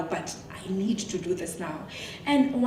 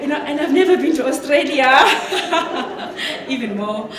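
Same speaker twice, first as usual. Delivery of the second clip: louder, higher, laughing disbelief; laughing excitement; 5 semitones higher, 10 dB louder than usual. Real, not acted. Marvelling at being known in Australia, laughing excitement. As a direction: exclaim it through real laughter. Thrilled that George watches abroad.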